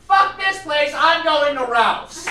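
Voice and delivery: high, defiant holler; yelled parting shot. Retold or imitated. Storyteller being escorted out of the store, defiant yell. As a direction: yell it out as you storm off. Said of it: "all I did was yell out".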